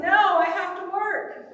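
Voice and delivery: loud, slow retold refusal; emphatic refusal. Turning down a summer in Japan, emphatic refusal. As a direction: push back firmly, drawing it out.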